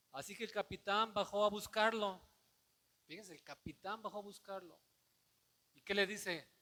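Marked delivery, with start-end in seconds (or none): 0.1-2.2 louder, ringing proclamation of the verse; loud scripture read. Shown as a demonstration. Pastor proclaiming the verse aloud to the church, ringing and loud. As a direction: announce the verse to the congregation. Reading the verse, then repeating it.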